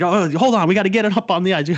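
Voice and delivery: high, fast excited rush; excited high rush landing a fish; freaking out. Retold or imitated. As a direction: blurt it out all excited. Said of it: "We both start freaking out".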